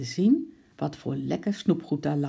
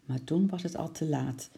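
plain reading voice

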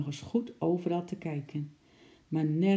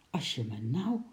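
grumbled low in surprise; a low astonished grumble; 4 semitones lower, 3 dB quieter than usual. A narrator's reading. Puzzled mover hears scratching, grumbling low to himself. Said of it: "bromde Rinus".

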